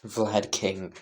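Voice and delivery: ordinary delivery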